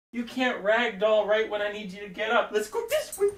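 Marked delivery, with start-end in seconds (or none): 2.5-3.3 very high singsong lure; puppy-luring voice. Real, not acted. Streamer luring puppy to walk, high singsong. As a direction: trick the dog into following, high and bright.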